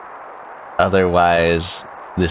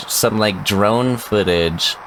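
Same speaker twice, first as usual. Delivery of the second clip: normal talking voice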